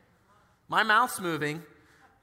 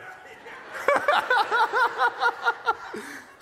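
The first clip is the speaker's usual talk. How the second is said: a burst of real laughter, much higher; loud high laugh; 5 dB louder than usual. Real, not acted. Preacher laughing at the coworker's grudging answer, loud high laugh.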